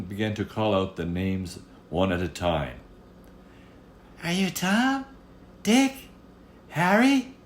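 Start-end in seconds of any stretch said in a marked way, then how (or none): 4.2-7.3 much higher, louder eager questions; hopeful guesses called out; guessing queen. Acted. Queen calls out names to the little man, high and eager. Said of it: "she asked".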